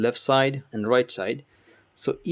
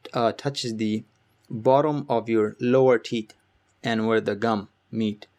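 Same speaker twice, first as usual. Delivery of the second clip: ordinary talk, nothing marked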